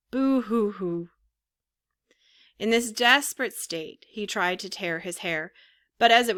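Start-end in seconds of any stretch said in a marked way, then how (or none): 0.1-1.1 stylized storybook boo-hoo; mock weeping. A narrator's reading. Puppet grieving for the fairy, mock weeping. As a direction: read out the sobs as words, mildly tearful. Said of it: "As he wept, he said".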